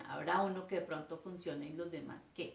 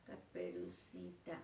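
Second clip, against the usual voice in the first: much softer, lower, slow and timid; timid pupil, much softer; barely audible child; 13 semitones lower, 11 dB quieter, slower than usual. Retold or imitated. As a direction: mumble it like a shy girl. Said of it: "Yo no oigo nada".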